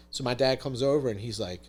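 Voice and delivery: normal talking voice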